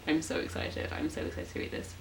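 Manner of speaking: soft, whispered giddy excitement; excited whisper. Real, not acted. Fan whispering her excitement to the camera. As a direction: hush it like a secret thrill.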